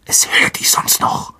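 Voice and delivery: urgent voiceless whisper; hissed. A narrator's reading. Harry warning Ron the creature will hear, hissed. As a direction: hiss the warning under your breath. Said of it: "zischte Harry".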